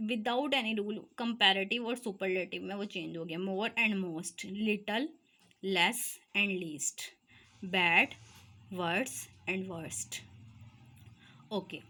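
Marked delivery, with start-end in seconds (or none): 3.4-10.2 teacher spelling out examples for the class, slow; slow example words. Shown as a demonstration. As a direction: say each form slowly and clearly. Teacher reads irregular forms.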